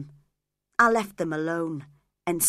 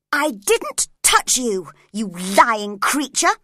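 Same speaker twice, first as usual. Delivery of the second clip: loud, slow furious denial; angry cry; 3 semitones higher, 8 dB louder, slower than usual. Acted. Catherine angrily denying she scratched Ellen, loud and slow. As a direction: bite out each word in anger. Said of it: "she cried angrily".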